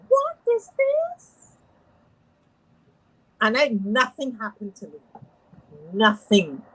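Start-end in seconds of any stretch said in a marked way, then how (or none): 0.1-1.3 high, slow, disbelieving squeak; baffled what. Retold or imitated. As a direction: ask it as if you can't believe your eyes. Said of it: "And I'm like".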